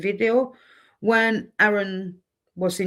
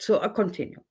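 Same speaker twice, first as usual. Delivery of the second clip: usual speaking voice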